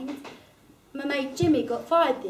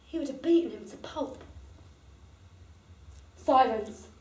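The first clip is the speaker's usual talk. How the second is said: ordinary talk, nothing marked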